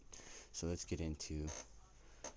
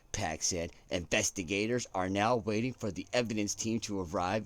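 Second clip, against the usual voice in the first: louder, measured newsreader tone; hammed-up broadcast read; news anchor accent; 5 semitones higher, 8 dB louder than usual. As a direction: deliver it like a network anchor. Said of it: "I don't know why I'm getting into this accent".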